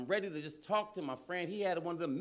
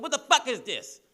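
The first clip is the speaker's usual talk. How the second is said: higher and louder with a laugh; mock shout at the food; disgusted outburst; 7 semitones higher, 9 dB louder than usual. Comic tasting the Impossible Burger, laughing mock outburst.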